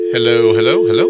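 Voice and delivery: very loud, echoing hello into the phone. Pretend. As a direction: shout hello down a dead line. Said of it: "doing a fantastic dial tone impression".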